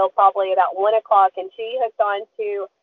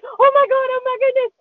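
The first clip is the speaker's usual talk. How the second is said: high, loud oh my God; excited squeal; 3 dB louder than usual. Retold or imitated. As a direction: shriek as your friend reels in a fish. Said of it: "she's grabbing my arm going".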